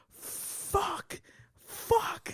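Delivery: strained, voiceless mock yell; imitated pained yell. All he could say while cramping, strained mock yelling. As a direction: yell it without real volume. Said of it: "like, yelling, yelling".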